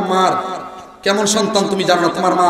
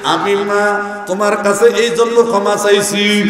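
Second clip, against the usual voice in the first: usual speaking voice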